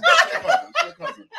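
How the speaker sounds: excited teasing. Real, not acted. Co-host teasing chant.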